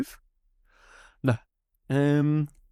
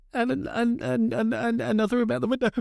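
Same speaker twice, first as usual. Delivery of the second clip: sheepish stammer; 10 semitones higher, faster than usual. Retold or imitated. Child confessing in a stammer.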